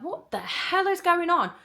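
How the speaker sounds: high-pitched retold "what is happening"; alarmed disbelief. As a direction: exclaim in rising alarm, retelling it. Retold alarmed reaction to the dog.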